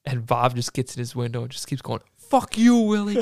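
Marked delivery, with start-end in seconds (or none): 2.2-3.2 high, loud mock-furious shout; hammed-up retold rage; angry yelling. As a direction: yell it after someone driving off. Retold fight, shouting at truck window.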